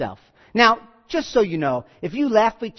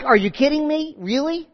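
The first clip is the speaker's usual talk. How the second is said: higher-pitched incredulous questioning; skeptical disbelief; 7 semitones higher than usual. Acted. Sarah answering God's promise of a child, skeptical. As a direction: act out Sarah doubting what she's been told. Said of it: "more of a skeptical, more of a, a disbelief".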